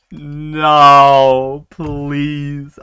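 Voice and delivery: loud begging. Real, not acted. Begging for a different character.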